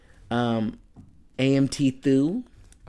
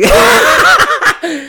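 loud laugh; 9 dB louder than usual. Real, not acted. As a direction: burst of high laughter. Laughing at the name.

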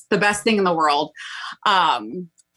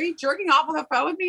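friend's incredulous question; 9 semitones higher, faster than usual. Retold or imitated. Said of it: "She's like".

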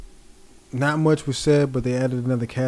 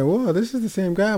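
high-pitched retold amazement; shocked; 9 semitones higher than usual. Fan recalling hearing the actor's real voice, shocked. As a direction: act out a startled realization, voice lifted. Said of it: "shocked me the most".

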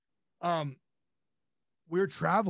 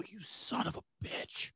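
hushed whisper of disbelief; whispered curse; 4 semitones higher, 11 dB quieter, slower than usual. Retold or imitated. Realizing his friend hid the Steve Austin call, whispered. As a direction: breathe it out slowly in mock shock. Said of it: "I go".

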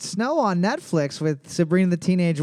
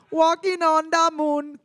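mock English accent; 10 semitones higher than usual. Friend copying the singer, light mock English accent. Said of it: "Don't say it with that accent".

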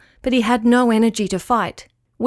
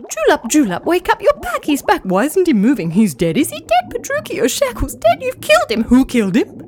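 high, frantic overlapping fish voices; frantic squeaky fish; panicked fish chatter; 6 semitones higher than usual. Acted. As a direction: babble in a panic.

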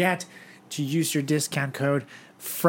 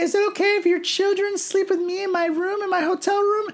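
falsetto voice; 6 dB louder than usual. Retold or imitated. Imitating [name] again.

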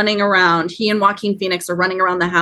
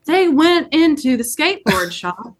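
mock police officer; 7 semitones higher than usual. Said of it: "She's like".

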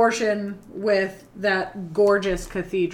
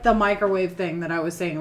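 ordinary talk, nothing marked

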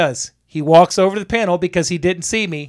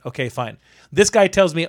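ordinary delivery

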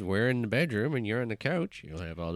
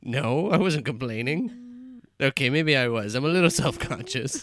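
defensive; 6 semitones higher, 6 dB louder, slower than usual. Real, not acted. Defensive protest.

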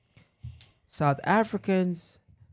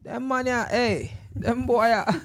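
playful Jamaican patois put on, slow on hey them boy; a joking Jamaican accent, drawn out; mock Jamaican patois; 5 semitones higher, slower than usual. Joking to Nigerian friends about the boys, mock Jamaican patois.

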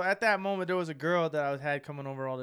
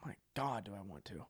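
soft, whispered aside; low, hushed and smitten; hushed swoon; 8 semitones lower, 10 dB quieter than usual. Retold or imitated. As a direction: murmur it half in a whisper. Acted breathless reaction to sexy accent.

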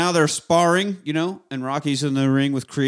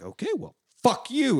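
high-pitched mock huff; acted pissy; 9 semitones higher than usual. Donnie storming off from his trainer, acted pissy. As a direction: toss it out high, as a piqued kiss-off.

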